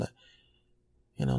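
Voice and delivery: ordinary talk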